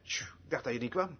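soft, low shushing; hushed aside. Retold or imitated. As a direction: shush someone discreetly in a meeting. Shushing on entering the meeting. (zacht en gedempt sussend)

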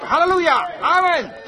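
high, loud cry of praise; jubilant shout. Retold or imitated. Son crying out at the scripture, jubilant shout. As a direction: shout a hallelujah to the heavens. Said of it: "Hij schreeuwde het uit en stak zijn handen in de lucht en riep".

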